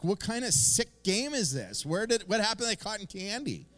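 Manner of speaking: high, loud, outraged disbelief; baffled raccoon. Retold or imitated. Pastor voicing a raccoon whose cotton candy vanished, indignant and high. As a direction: play a raccoon robbed of its treat.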